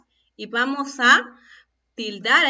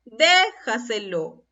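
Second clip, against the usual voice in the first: Déjaselo slowly, leaning on "Dé"; stress on DE; slower than usual. Shown as a demonstration. Teacher reads the example, "Dé" stressed and held in Déjaselo. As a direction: hold the first syllable of Déjaselo. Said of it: "es una palabra sobre esdrújula".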